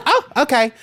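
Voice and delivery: high surprised voice. Retold or imitated. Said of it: "she goes".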